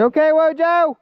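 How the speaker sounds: high-pitched check on a fallen buddy; calling out. Real, not acted. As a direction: call over to see if they're okay. Calls to fallen friend.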